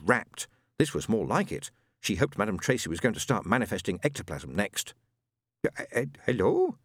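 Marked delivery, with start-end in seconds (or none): 5.6-6.8 hesitant man's voice, lightly voiced; a timid husband on the line; Ron's voice. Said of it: "in another voice".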